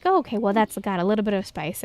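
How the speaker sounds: ordinary delivery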